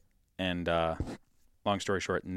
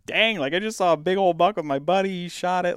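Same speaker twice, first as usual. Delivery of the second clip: much higher and louder, buzzing with it; excited retelling; 11 semitones higher, 7 dB louder than usual. Retold or imitated. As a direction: still hyped about the buck. Retelling the hunt at home.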